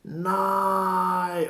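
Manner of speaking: a high, sharp shouted no; retold shout. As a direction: shout back a desperate refusal. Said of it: "Og så råber han".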